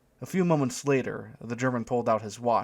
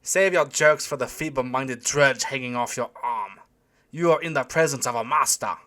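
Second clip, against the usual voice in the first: German shouting; 3 semitones higher, 6 dB louder than usual. A narrator's reading. German usher to giggling couple, haughty raised voice. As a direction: scold them haughtily in a German accent. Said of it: "the German continued to shout at them".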